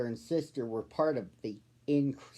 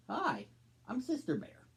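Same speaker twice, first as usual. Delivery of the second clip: much higher, bright friendly greeting; friendly little girl; 9 semitones higher, 5 dB quieter than usual. Acted. Sister greets the new cub, higher cheerful little-girl voice. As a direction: introduce yourself with a big smile. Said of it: "a big smile and said".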